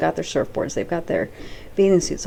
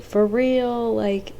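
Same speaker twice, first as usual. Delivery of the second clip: surfer dude voice; 5 semitones higher than usual. Retold or imitated. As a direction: say it like a chill beach surfer. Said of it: "Like, he's a surfer dude".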